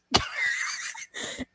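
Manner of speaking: giggling high and breathy; high squeaky laugh. Real, not acted. Laugh after voice crack.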